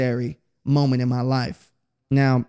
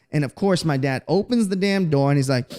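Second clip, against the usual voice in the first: ordinary delivery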